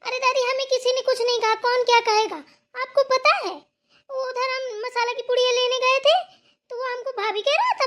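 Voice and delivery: high-pitched, aggrieved outburst; indignant complaint. Acted. Daughter-in-law complaining to grandmother about the spice seller, indignant.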